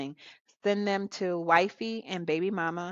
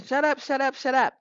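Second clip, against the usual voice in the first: higher, louder mock yell of shut up; mock screaming kids; 7 semitones higher, 4 dB louder than usual. Retelling the sitcom kids' fight, mock shouting. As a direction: play the bickering kids yelling at each other. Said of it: "They're all screaming".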